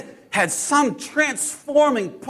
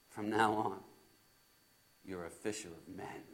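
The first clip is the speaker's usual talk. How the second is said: hushed reverence; 13 semitones lower, 19 dB quieter than usual. Acted. Peter quotes Jesus' calling words.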